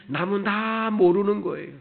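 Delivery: high, loud exhortation from the pulpit; impassioned preaching. Real, not acted. Preacher builds to forceful exhortation. (높고 힘찬 목소리로 열띤 설교)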